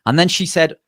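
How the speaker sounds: ordinary talk, nothing marked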